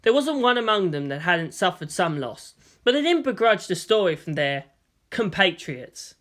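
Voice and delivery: ordinary talk, nothing marked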